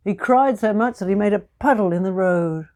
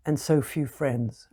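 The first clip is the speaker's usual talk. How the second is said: usual speaking voice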